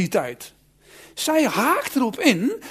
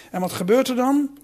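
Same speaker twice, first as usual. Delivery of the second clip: ordinary talk, nothing marked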